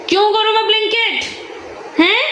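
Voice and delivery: mother overplaying her refusal to the son; exaggerated protest. Real, not acted. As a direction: stretch the refusal in mock outrage. Repeating the protest, stronger.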